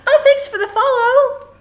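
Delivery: high laughing burst. Real, not acted. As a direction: laugh through the words. Dancing robot, laughing.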